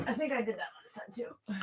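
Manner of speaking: whispered sheepish aside; whispering. Real, not acted. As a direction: whisper it under your breath. Sheepish aside after desk bump.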